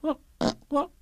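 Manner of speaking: high, halting stammer; tiny stuttering question; alarmed Piglet. Acted. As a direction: gasp out each word nervously. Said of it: "said Piglet".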